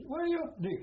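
high, loud call of recognition, retold; excited greeting. Old soldier imitating the little cockney hailing him, high and loud. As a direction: hail an old mate across the field, mock loud.

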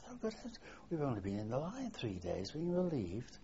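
swooping pitch, mock astonishment; retold surprise. Veteran retells troops' reaction to being relieved, surprised. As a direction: act out mild disbelief, without raising the volume.

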